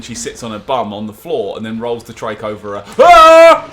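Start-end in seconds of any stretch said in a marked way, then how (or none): 3.0-3.7 high loud startled shriek; startled scream. Real, not acted. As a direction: shriek at a sudden fright. Trike rolls over in game.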